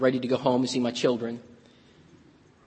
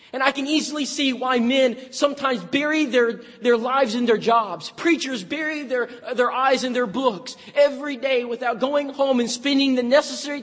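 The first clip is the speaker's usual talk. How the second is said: raised, building sermon voice; Southern preacher in full flow; preaching; 9 semitones higher than usual. Acted. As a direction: preach it out to the church.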